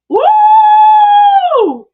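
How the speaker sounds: very high, very loud, long woo; shrieked whoop. Real, not acted. Host reacts to a startling comment, shrieked whoop. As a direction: let out one long piercing whoop.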